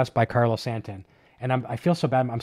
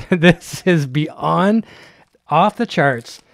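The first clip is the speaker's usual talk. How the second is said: laughing, louder and drawn out; overjoyed; 4 semitones higher, 6 dB louder, much slower than usual. Real, not acted. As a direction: let the joy spill over, slowly. Overwhelmed by a thoughtful gift.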